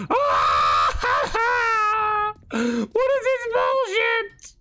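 high shrieking cry; panicked cry. Real, not acted. Ambushed by bear.

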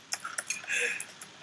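breathy high giggle; high laugh. Real, not acted. Laughing at a joke.

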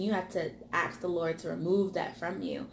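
normal talking voice

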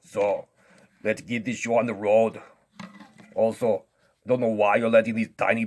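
loud, cocky strongman voice; boastful strongman. Pretend. Strongman interrupts the whispered lesson, loud and boastful. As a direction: boast at full volume, cutting in.

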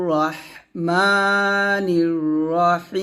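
slow chanted রহমানির রহিম with heavy র; tajweed recitation. Shown as a demonstration. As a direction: recite it slowly, র thick. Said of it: "এরকম".

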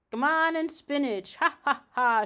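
loud gloating magpie cackle; gloating magpie shouting from the yard; triumphant shout. Acted. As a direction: crow it out in triumph. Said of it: "shouted Doctor Maggotty triumphantly".

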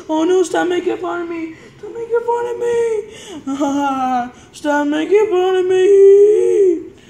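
high, slow fake sobbing whine; mock crying. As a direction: whimper through laughs while being teased. Crying while being teased.

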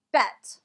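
"bet" with a short eh vowel. Shown as a demonstration. Teacher showing students the short E, short-E pronunciation. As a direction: say "bet" with a brief, open E. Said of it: "it's pronounced as a short E".

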